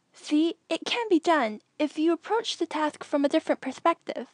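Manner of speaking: put-on a proud kid who loved the puzzle; young Anakin pleased.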